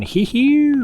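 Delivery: high, creepy little hee hee; creepy clown giggle. Pretend. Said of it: "Sound like a creepy clown".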